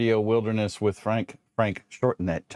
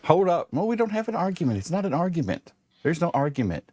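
protesting; 7 semitones higher than usual. Real, not acted. Stops co-hosts, denies argument.

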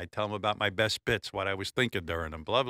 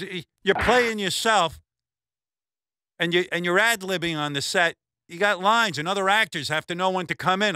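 higher-pitched retold scolding, lightly acted; imitating a scolding director; 9 semitones higher, 3 dB louder than usual. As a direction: lecture him like an exasperated director, half-mocking. Director scolding actor on set.

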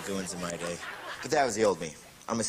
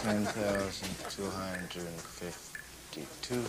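slow counting; much slower than usual. Acted. Counting grains slowly.

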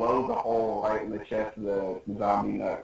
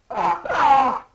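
high, loud mock cry; anime-style howl; acted wail; 9 dB louder than usual. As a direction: wail in hammed-up dismay.

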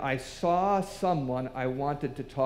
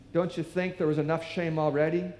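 ordinary talk, nothing marked

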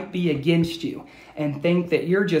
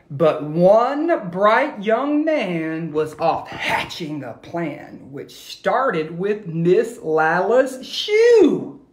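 put-on rhythmic read-aloud for kids; lilting rhyming storyteller voice; story narration. A narrator's reading. Teacher reading a rhyming story to her class, lilting.

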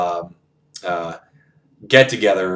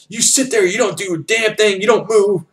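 high, strained officer imitation; scolding general; 10 semitones higher than usual. General tells the refusing scout to stay put, scolding. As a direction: lay down the law in a high mock-stern voice.

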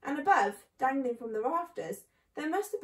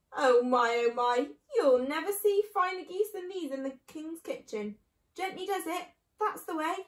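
loud start, then higher, lilting delight; delighted marvelling; 5 semitones higher, 5 dB louder than usual. A narrator's reading. Mister Fox lifting down fine geese, delighted. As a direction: marvel aloud, then coax gently.

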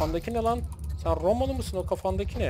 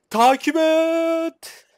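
drawn-out command; 9 semitones higher, 7 dB louder, much slower than usual. Acted. Ordering troops to follow.

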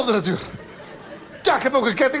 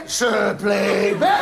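drawn-out slow exclamation; 4 semitones lower, much slower than usual. Acted. Comic partner drawing out the punchline very slowly. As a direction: drag every syllable out for comic effect.

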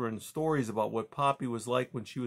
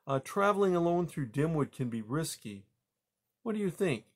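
higher-pitched, coaxing mother; hopeful mother mouse; 6 semitones higher than usual. Acted. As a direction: invite your son along, lightly pitched up. Mother invites her son along.

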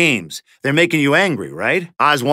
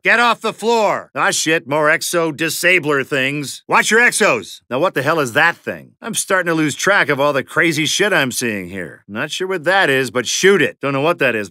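ordinary delivery

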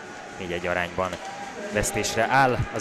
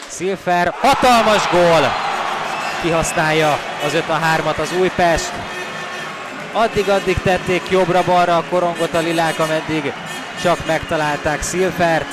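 excited hungarian sports commentary; 5 semitones higher, 5 dB louder than usual. Real, not acted. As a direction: stretch out the goal call, high and loud. Power-play goal, live call.

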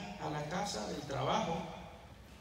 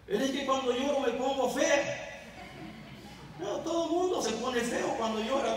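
whining wife; 6 semitones higher than usual. Retold or imitated. Wife and husband quarrel voiced.

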